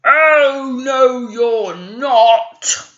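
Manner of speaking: loud, slow troll roar. A narrator's reading. Troll halting the middle goat, loud and slow. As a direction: bellow to stop the goat cold. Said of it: "roared the Troll".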